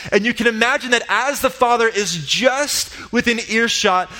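ordinary delivery

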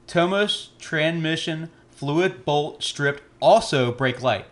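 very slow, every word spelled out; slow careful reading. Shown as a demonstration. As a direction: read it out slowly and deliberately. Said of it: "listen carefully".

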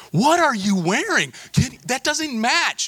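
high, loud, drawn-out mock outrage; hammed-up sisterly horror, stretched slow; freaking-out older sisters. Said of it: "they're, like, freaking out".

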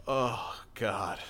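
ordinary talk

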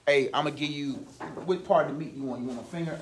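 low, hushed pretend offer; mock casual offer. Teacher mimicking an offer Jesus never made, low and hushed. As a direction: toss it off softly, like a side deal.

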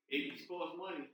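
ordinary talk, nothing marked

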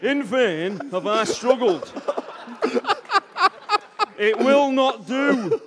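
loud, high, very slow Yorkshire declaration; hammed-up Yorkshireman booming each word out slowly; Mick McCarthy impression. Mister Darcy declaring love to Elizabeth, comic loud Yorkshire voice. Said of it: "Mick McCarthy".

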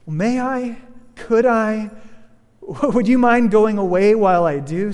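put-on timid girl voice rising in worry; nervous girl's plea. A narrator's reading. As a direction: Jill pleading anxiously with the lion. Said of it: "said Jill".

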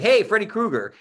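put-on high, loud kid's cheer of recognition; excited kids. Kids greeting their teacher's old haunted house character, excited. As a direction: shout it like thrilled kids spotting him.

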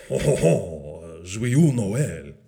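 French Santa. Acted. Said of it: "How about in French?".

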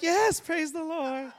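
forced congratulations. Acted. Loser forcing congratulations.

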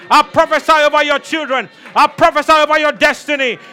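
loud, driving prophetic declaration; preaching at full force. Acted. Preacher prophesying over the congregation, shouting. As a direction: declare it over them, building.

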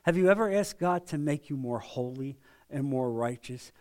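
tender, sincere invitation; loving, gentle. Shown as a demonstration. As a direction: ask gently, as if to a friend you care for. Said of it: "when you talk like that in a loving way".

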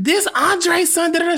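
put-on very high gushing older lady voice; high imitated older lady. Stranger at party gushing to him, very high mock voice. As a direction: squeal it like an excited stranger.

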